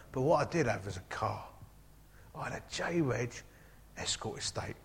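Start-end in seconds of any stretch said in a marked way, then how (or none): 2.3-4.8 breathy near-whisper, very soft; hushed fondness. Real, not acted. To the congregation about his beloved old car, hushed. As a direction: murmur it like a treasured secret.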